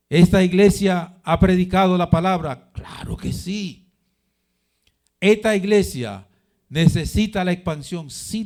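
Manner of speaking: high, loud rhetorical questions to a congregation; full-force preaching. Real, not acted. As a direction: fire out each question and ring out the answer.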